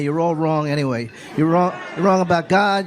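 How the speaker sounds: usual speaking voice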